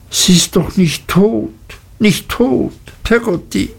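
put-on high, pleading, frightened boy's voice; a child begging it isn't true. A narrator's reading. Boy begs his nurse to say mother isn't dead, frightened pleading.

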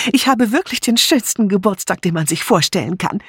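put-on high-pitched, delighted older friend's voice; excited Dora. A narrator's reading. Dora thrilled by her birthday party, high and excited.

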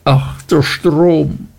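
slow, high, anguished moan; despairing lament. A narrator's reading. Martha moans her despair at the river, slow and high. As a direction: let the grief pull the words out slowly.

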